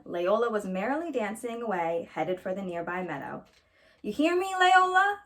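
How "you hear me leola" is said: higher, louder call across the yard; grandma hollering after a child running off; shouting after her. Acted. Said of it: "Grandma shouted after her".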